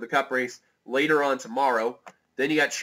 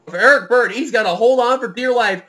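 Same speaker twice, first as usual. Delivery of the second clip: raised, urgent race commentary; live play-by-play; 9 semitones higher, 12 dB louder than usual. Real, not acted.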